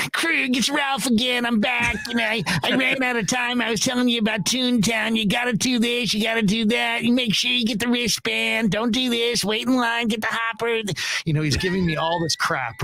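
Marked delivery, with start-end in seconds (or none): 0.1-10.8 high-pitched rambling phone-message impression; Ralph. Colleague leaving yet another voicemail of tips, high impersonated voice. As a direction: rattle off tips in his high, insistent voice. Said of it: "then he'd be like".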